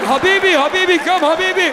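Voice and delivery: high, urgent mock calling. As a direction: imitate someone beckoning a friend, pitched well up. Retold calling to a rival.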